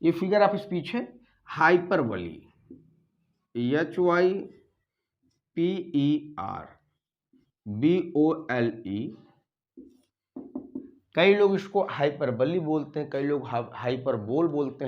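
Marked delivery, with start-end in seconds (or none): 3.5-9.1 letters spelled out slowly one by one; slow spelling. Shown as a demonstration. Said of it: "spelling the word letter by letter".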